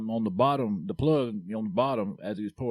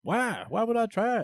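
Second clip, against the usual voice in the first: high-pitched incredulous questioning; acted disbelief, pitch swooping up; incredulous retort; 11 semitones higher than usual. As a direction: push back in a much higher voice. Acted reply refusing the offer.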